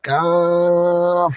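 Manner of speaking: কাফ drawn out slowly and lightly, no force; কাফ chanted slow with no extra force; natural Qaf. Shown as a demonstration. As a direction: model কাফ gently for students to repeat. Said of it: "আবার পড়ুন".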